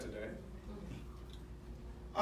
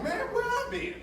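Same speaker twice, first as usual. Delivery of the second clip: mock amazement. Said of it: "I'm like".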